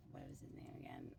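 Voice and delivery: hushed question to yourself; soft unvoiced whisper as you forget a name; whispered aside. Real, not acted. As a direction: whisper to yourself, trying to remember. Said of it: "I forgot".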